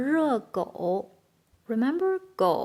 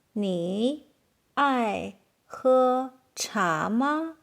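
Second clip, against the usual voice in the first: slow question with gaps between words; much slower than usual. Shown as a demonstration. Teacher asking learners about tea, slow and word by word.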